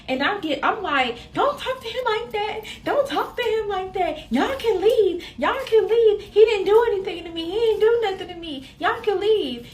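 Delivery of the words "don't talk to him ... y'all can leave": very high, insistent retold protest; indignant defending. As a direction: shrilly order them out, defending him. Retold defending partner against mother.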